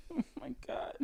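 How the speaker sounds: high, laughing fake distress; mock distraught girlfriend. A girlfriend sobbing over her ex, played for laughs. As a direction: ham up a girlfriend crying over an ex. Acting girlfriend crying at ex's death.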